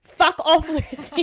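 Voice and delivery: play-acted shout of dismissal; angry outburst. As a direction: explode at your partner in a skit. Acted breakup ends in outburst.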